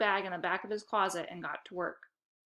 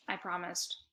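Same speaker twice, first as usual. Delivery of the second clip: ordinary delivery, nothing marked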